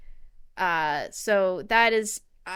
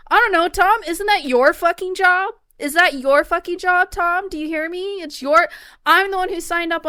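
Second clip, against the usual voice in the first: louder, higher, exasperated yelling; mock angry yell; 7 semitones higher, 8 dB louder than usual. Player yelling at Tom Nook, mock furious. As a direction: chew out the raccoon like a lazy boss.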